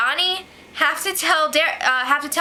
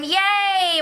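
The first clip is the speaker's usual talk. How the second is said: higher, sung mock cheer; sarcastic singsong yay; 7 semitones higher than usual. Real, not acted. Vlogger mocking the camera news, singsong sarcastic cheer. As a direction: cheer with no joy at all.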